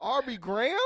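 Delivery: high, loud rapper taunt; [name] impression. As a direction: shout the name like a cocky rapper. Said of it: "That's my best [name] impression".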